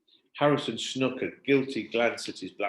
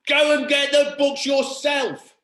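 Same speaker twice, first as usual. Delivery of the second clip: put-on higher, slow furious child shout; 9 dB louder, slower than usual. A narrator's reading. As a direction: bellow it at the teacher. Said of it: "what he wanted to shout".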